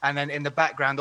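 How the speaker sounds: ordinary talk, nothing marked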